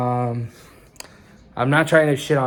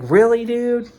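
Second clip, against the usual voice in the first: high exasperated really; 10 semitones higher, 5 dB louder than usual. Retold or imitated. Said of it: "I'm like".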